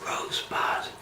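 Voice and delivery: hushed whispered quote. Retold or imitated. Quoting the film's whispered word, in a whisper. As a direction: whisper it like a famous last word. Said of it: "the whispered word".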